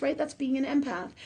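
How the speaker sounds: ordinary talk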